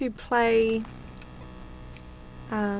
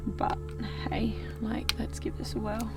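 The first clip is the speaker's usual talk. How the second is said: whispering; 7 dB quieter than usual. Real, not acted. Hushed aside before investigating.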